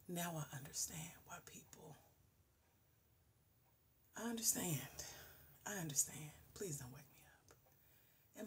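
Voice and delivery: soft unvoiced whisper, slowing down; hushed pleading whisper; whispered plea. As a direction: beg quietly not to be woken. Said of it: "And I've never been so like".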